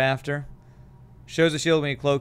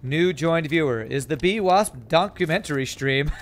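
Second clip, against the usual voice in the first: usual speaking voice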